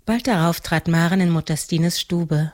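ordinary delivery, nothing marked

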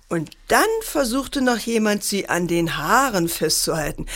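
ordinary delivery, nothing marked

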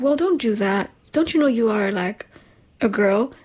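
ordinary delivery

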